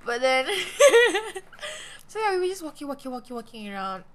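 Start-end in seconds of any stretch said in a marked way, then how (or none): 0.5-1.4 loud, high burst of laughter. Real, not acted. Friend chatting about the convention, loud high laugh. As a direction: break into a loud laugh mid-story. Laughs while telling the story.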